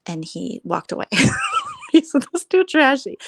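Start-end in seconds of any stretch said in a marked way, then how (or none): 1.1-1.9 squealing high laugh; high burst of laughter. Real, not acted. Vlogger laughing at friend's verdict, squealing high laugh. 1.9-3.2 high, loud, laughing through it; laughing retelling. Real, not acted. Vlogger retelling friend's remark, laughing.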